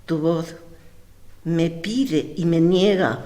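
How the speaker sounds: ordinary delivery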